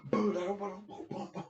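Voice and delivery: high, far-off playful boom boom boom; mock boom noises. Imitating the bang of a fist on a car window, playful booms. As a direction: pop out boom noises like punches landing.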